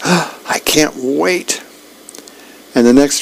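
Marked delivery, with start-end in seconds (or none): 0.0-1.6 slow, hushed and dreamy; awed whisper of longing; breathless anticipation. Acted. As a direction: savour each word like dessert. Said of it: "And Dagwood goes".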